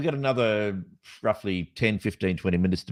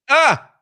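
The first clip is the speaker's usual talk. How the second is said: sharp high yelp, loud; startled yelp; 9 dB louder than usual. Real, not acted. Host startled by the screen, loud high yelp.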